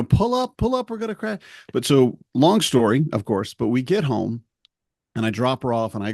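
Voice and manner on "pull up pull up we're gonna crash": much higher panicked cry; hammed-up cockpit alarm; mock panic. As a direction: shriek it like a plane going down. Mock crash alarm joke.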